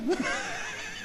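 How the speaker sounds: short high giggle; high laugh. Real, not acted. Preacher laughing at his own joke, a short high giggle. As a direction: burst into a quick laugh.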